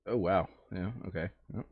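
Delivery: usual speaking voice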